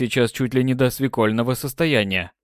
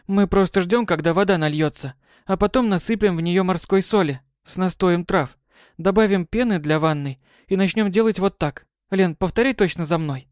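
put-on demanding girl; 7 semitones higher than usual. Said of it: "потребовала в конце Май".

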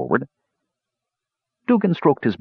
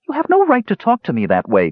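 high, protesting retort; indignant retort; 14 semitones higher than usual. A narrator's reading. Palin to his mocking brother, indignantly retorting. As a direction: object sharply, pitched well up. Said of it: "Palin retorted".

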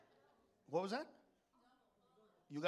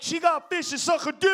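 loud, much higher mock outrage; Randy's shout; 8 semitones higher, 10 dB louder than usual. Randy yelling at the joke, loud and high. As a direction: yell it like a cracking-up friend. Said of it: "Even Randy's like".

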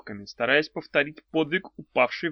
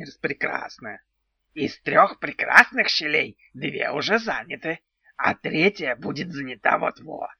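brisk matter-of-fact goblin voice; businesslike goblin; 3 semitones higher than usual. A narrator's reading. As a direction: goblin reporting like a clerk. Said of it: "деловито сообщил".

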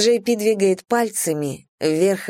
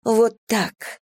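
higher, drawn moan; a touch of a moan on the words; 5 semitones higher than usual. A narrator's reading. A woman urging her lover on, moaning. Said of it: "стану я".

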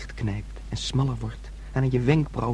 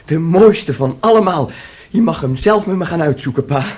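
eager excitement; 6 semitones higher, 8 dB louder than usual. Acted. Son eagerly promises a piano.

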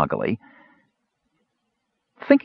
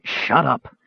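harsh hush; 5 semitones higher than usual. A narrator's reading. Caramon silencing the kender in the alley, harsh. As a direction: silence him fast.

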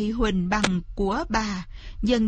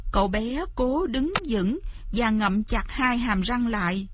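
ordinary delivery, nothing marked

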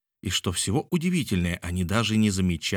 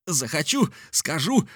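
high, punchy comeback; defiant retort; 9 semitones higher than usual. A narrator's reading. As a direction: throw it back in raised voice. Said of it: "ответил Мишель, резко хватая". (с вызовом)

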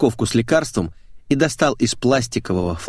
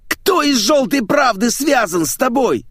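slow, high, full-force roar; 4 dB louder than usual. Acted. Boss demanding who her contact is, roaring. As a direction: roar out a furious interrogation. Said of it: "заревел Сергей".

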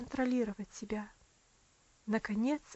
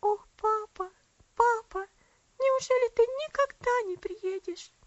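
put-on much higher, loud, pleading little girl; a feverish child calling for her papa; sick girl cries for her absent father; delirious child; 10 semitones higher, 6 dB louder than usual. A narrator's reading. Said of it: "бормоча".

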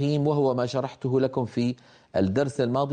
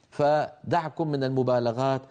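usual speaking voice

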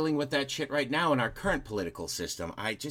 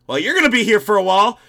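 fast, high, loud re-enacted chat; calling out; 7 semitones higher, 11 dB louder, faster than usual. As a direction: holler it across a crowd. Acts out shouted chat over crowd.

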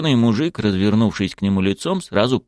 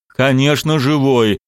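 louder, raised irritated voice; angry retort; 6 semitones higher, 5 dB louder, slower than usual. Acted. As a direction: snap back, annoyed and louder. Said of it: "разозлился Шамиль". (раздражённо и громче)

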